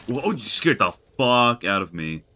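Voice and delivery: loud, shocked yelp; startled outburst. Real, not acted. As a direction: jump out of your skin. Startled by a game scare.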